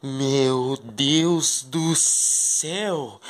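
very slow, hushed amazement; breathless wonder at the garden; awed. Pretend. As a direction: draw it out in wonder. Seeing the secret garden.

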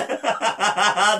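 delighted run of laughter; joyful laugh. Real, not acted. Preacher rejoicing over forgiveness, joyful laugh. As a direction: laugh with relief and joy.

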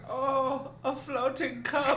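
high, slow put-on voice; mock wonder. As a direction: imitate a spooky marvel at a simple trick. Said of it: "He's like".

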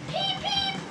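extremely high engine peeps; frantic whistle peeps. A narrator's reading. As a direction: imitate a little train's alarm whistle.

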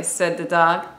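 ordinary delivery, nothing marked

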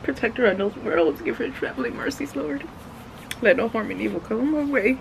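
soft earnest prayer, some lines hushed. Real, not acted. Mom praying for her daughter's safe travels, soft and earnest.

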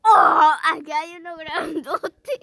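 loud, slow startled shout. Real, not acted. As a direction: yell it out, half laughing. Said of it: "Perdón por gritar".

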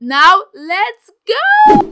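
loud, high, drawn-out kickoff yell; stretched-out rallying shout; excited hype shout. Real, not acted. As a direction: launch the game with a long whoop. Hyping the start of the game.

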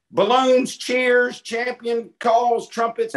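real excited fanfare for the guest; excited hype. Real, not acted. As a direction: pump up the crowd like a party host. Hyping up the guest intro.

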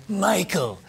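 lightly voiced Godfather-style Italian-American accent; a hint of a menacing mob boss, Italian-American accent. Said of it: "if you've ever seen The Godfather".

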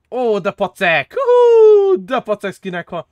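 slow, loud, high gasp of delight; amazed delight. Real, not acted. Browsing a cool skin, loud delighted exclamation. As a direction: marvel out loud. Sees great skin in shop.

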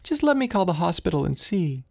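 high-pitched voice of an anxious mother; worried mother. A narrator's reading. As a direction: plead like a frightened mother. Mother's voice in the story.

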